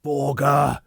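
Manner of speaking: sudden loud high cry; loud anguished cry of recognition; cried out. Acted. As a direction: cry the name out in shock. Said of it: "he cried out".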